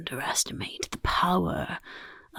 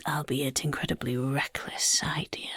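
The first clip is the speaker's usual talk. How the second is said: ordinary talk, nothing marked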